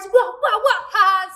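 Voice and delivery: high, loud, playful "wah" bursts; imitated throwing noises. Storyteller mimicking teens pelting cars, playful loud noises. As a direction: punch out each throw as a noise.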